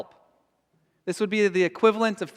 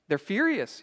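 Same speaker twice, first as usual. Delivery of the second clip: ordinary talk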